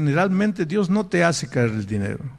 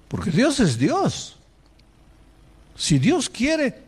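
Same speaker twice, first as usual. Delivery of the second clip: higher, slow and emphatic; slow proclamation; 8 semitones higher, slower than usual. Acted. Preacher proclaims to the congregation, higher and slow. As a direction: lift it up slowly like a proclamation.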